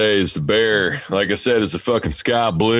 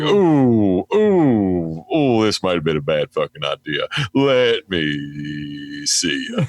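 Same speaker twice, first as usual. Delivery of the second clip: nervous oohs sliding down to a slow plea; nervous coaxing; slower than usual. Retold or imitated. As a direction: edge away while coaxing the dog. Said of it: "I was just like".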